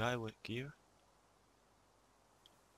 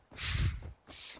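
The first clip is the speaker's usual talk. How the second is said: breathy laugh; 4 dB louder than usual. Real, not acted. Laughing at casually eating player.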